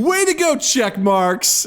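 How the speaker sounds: high, condescending mock praise; imitated patronizing cheer. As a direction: talk down while congratulating. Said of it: "you're being super patronizing".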